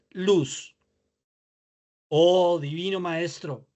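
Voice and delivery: measured prayer with long pauses between phrases; slow prayer reading. Real, not acted. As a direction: read the prayer slowly, lifting each opening phrase and dropping on its answer. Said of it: "leer despacio". (oración leída despacio, con pausas)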